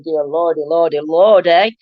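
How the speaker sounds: ordinary talk, nothing marked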